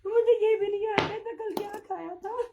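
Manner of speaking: self-mocking laugh about her memory. Real, not acted. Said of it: "इसलिए हंसी".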